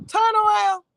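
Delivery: retold sharp command; officer's command. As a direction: call it out like a cop giving orders. Retelling officer's order.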